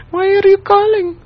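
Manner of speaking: very high falsetto mimicry; caller impression. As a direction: squeak like the caller on the prank call. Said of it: "He's like".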